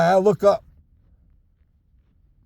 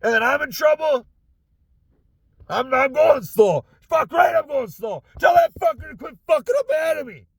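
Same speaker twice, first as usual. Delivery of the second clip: high, strained, fed-up outburst; real exasperated ranting; indignant venting; 7 semitones higher than usual. Real, not acted. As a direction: protest the blame with pitch pushed high. Fuming over being blamed for slowness.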